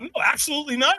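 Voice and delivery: high-pitched, firm retold no; emphatic refusal. Man tells stranger he is not walking, emphatic refusal. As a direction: shut the idea right down.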